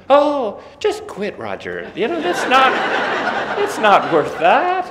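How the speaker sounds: high, loud, laughing mother brushing it off; breezy mom voice. Retold or imitated. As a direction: casually tell him to just quit. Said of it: "she would just say".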